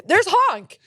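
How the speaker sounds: calling out. Retold or imitated. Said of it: "I was gonna be like".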